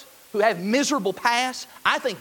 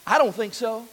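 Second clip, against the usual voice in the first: usual speaking voice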